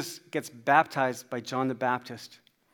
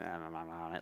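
very low, soft mumbled dismissal; gruff brush-off; 9 semitones lower, 15 dB quieter than usual. Retold or imitated. Construction man accepting an apology, low mumble. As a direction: mumble it down low, waving it away. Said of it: "in his own way, kinda said".

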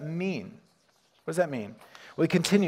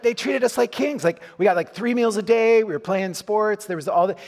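excited retelling; 6 semitones higher, 4 dB louder than usual. Retold or imitated. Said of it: "He said".

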